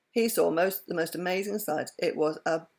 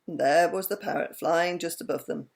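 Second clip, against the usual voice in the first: ordinary delivery, nothing marked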